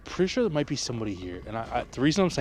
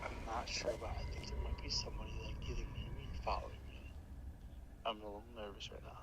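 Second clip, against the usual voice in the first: hushed nervous whisper on a call; whispering on the phone; 11 dB quieter than usual. Real, not acted. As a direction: whisper so no one hears. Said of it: "Why are you whispering?".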